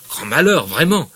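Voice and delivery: Pécuchet mocks Bouvard's grief, loud and sarcastic; sarcastic retort. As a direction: throw his outrage back at him. Acted quarrel, sarcastic reply.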